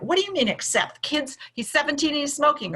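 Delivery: mock alarmed parent; panicked parent. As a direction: imitate a worried parent panicking. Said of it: "kind of get panicked".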